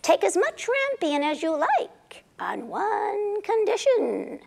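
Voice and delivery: breathy, slow witch whisper. Acted. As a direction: hiss it out like a scheming witch. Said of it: "said the Wicked Witch".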